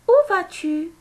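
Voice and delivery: très haut et fort, montée de question; question modèle. Shown as a demonstration. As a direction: lance la question bien haut, pour la classe. Maîtresse répète la question. (question posée très haut et fort)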